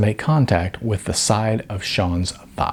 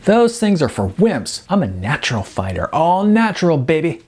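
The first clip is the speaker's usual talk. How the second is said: cocky Sean; 6 semitones higher than usual. A narrator's reading.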